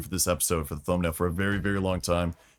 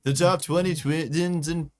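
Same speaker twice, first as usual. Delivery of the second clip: announcer voice; 5 semitones higher, slower than usual. Pretend. Mock title announcement.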